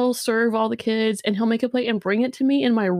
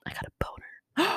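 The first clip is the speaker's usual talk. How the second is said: breathy whispered confession, higher; hushed confession; 7 semitones higher, 10 dB quieter than usual. Retold or imitated. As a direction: whisper it like a shameful secret.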